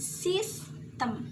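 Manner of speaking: slow "sistem", first syllable up, second down; rise-then-fall intonation. Shown as a demonstration. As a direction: stretch "sistem" and let the ending fall.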